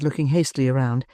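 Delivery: ordinary delivery, nothing marked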